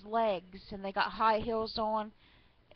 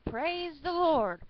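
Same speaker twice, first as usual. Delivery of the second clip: loud, high, drawn-out cry to heaven; yelled praise; 7 semitones higher, 6 dB louder, slower than usual. A narrator's reading. Old lady from her porch, acted yelling. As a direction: belt it out slowly, high and loud. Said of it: "or so of her yelling".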